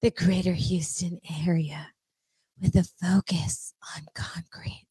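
soft low breathy read; sexy voice. Pretend. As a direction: drop low and soft, seductive. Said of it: "Sexy voice".

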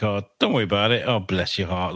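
sugary condescending, quick dismissive "Oh, bless your heart"; mock sweet put-down. Imitating a patronising friend brushing someone off. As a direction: fake sympathy that means a put-down. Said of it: "Like, that's like".

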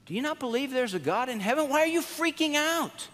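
high, disbelieving inner protest; acted bafflement, climbing in pitch; incredulous questioning. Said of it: "And I'm thinking".

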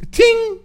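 a high, sharp ding like a bell; bell ding sound. Real, not acted. Host dings a bell sound for a good comment. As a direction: ring out a quick ding of approval.

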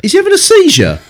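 high-pitched disbelief; incredulous. Real, not acted. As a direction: squeak it out in mock shock. Mocking a character on screen.

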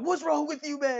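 high mock outrage. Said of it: "everybody's like".

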